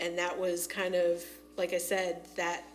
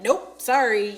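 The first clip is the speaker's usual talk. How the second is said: louder, higher dismissive brush-off; mock blunt refusal; 4 semitones higher, 11 dB louder, slower than usual. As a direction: play a loud, unapologetic turnaround. Acting out players turning on others.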